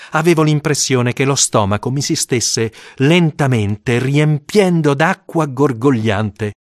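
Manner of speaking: plain reading voice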